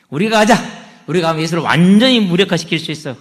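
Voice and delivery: Sadducees spurring each other on, loud; eager loud rallying call. Acted.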